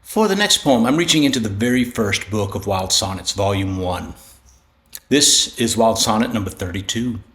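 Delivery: ordinary delivery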